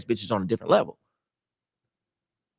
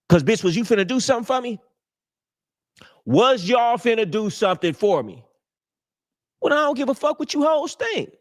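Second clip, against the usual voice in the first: high-pitched, brash challenge to the women; high, defiant call-out; defiant confrontation; 10 semitones higher than usual. Acted. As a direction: throw it at them high and indignant.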